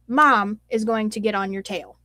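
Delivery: normal talking voice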